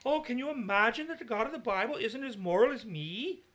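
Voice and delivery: sing-song Swedish mockery of a self-righteous progressive; mock Swedish accent. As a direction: lift the voice high and put on a Swedish lilt to mock. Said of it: "Why I did that in Swedish".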